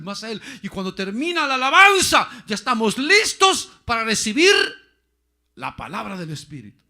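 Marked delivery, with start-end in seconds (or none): 0.6-4.5 high, loud preacher's cry; fervent pulpit call; full-force preaching. Acted. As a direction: lift the congregation with a shout. Preacher building to climax.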